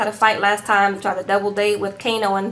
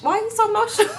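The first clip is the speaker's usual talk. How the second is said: baffled question; 10 semitones higher, faster than usual. Retold or imitated. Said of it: "I said".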